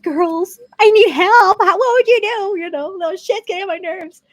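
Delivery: high, loud hammed-up cry for help; hammed-up frantic mom asking for advice; mock desperate plea. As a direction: beg the girls for help in mock panic.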